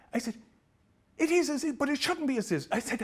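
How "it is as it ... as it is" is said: mocking angry man. Angry man mocks phrase.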